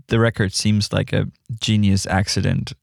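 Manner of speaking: normal talking voice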